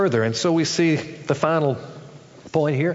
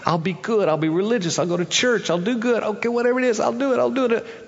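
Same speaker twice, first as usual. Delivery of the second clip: climbing higher, more eager promises; mock eager bargainer; 8 semitones higher, faster than usual. Churchgoer promising God good deeds with eager rising pitch. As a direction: overpromise to earn it.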